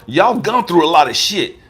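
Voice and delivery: ordinary talk, nothing marked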